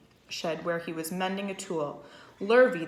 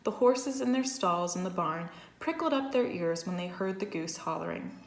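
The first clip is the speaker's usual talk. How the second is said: ordinary delivery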